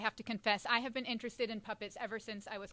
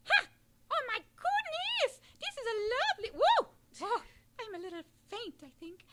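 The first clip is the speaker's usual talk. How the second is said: Featherly puppet voice; 12 semitones higher, 7 dB louder than usual. Acted. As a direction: swoon as a fainting feathered puppet. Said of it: "my name is Featherly".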